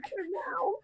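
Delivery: ordinary talk, nothing marked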